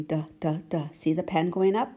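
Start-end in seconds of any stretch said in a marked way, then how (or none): 0.0-0.9 short repeated duh sounds; letter sound d. Shown as a demonstration. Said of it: "Then his tongue goes up the building".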